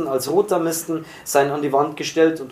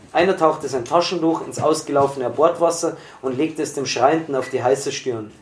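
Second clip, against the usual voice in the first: plain reading voice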